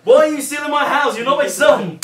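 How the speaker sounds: very high, loud mock shriek; angry mum. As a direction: scream it as a hammed-up furious mother.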